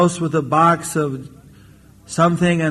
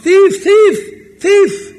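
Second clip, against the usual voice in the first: loud, very high alarm yell; shouting out; 7 dB louder, much slower than usual. Acted. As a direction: yell ahead as if chasing a culprit. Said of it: "He's shouting out".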